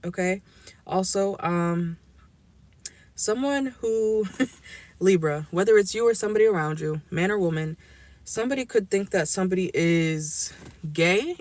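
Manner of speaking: usual speaking voice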